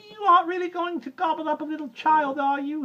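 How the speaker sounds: very high, quavering frightened monkey; shaking monkey. Acted. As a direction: tremble as you ask. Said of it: "began to shake all over".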